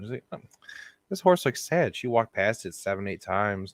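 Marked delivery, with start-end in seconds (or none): 0.3-2.0 put-on old lady voice. Old English lady voiced again.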